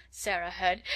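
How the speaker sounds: ordinary delivery, nothing marked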